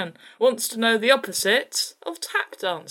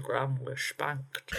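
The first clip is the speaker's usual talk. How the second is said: lightly imitated Sean Connery; a hint of Sean Connery, slushy s; Sean Connery impression; 6 semitones lower, 9 dB quieter, much slower than usual. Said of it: "How would Sean Connery say it?".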